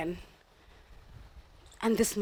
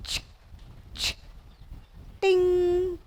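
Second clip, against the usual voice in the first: lift sound; 7 semitones higher, 4 dB quieter, much slower than usual. Retold or imitated. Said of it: "which this time goes".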